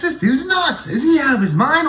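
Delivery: high mock-incredulous onlooker voice; squeaky, scandalized bystander; stunned onlooker. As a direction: play a flabbergasted bystander.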